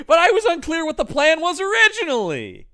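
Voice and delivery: high, loud, hammed-up complaint; mock exasperated yell. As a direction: wail it at the screen in mock despair. Retells yelling at the screen.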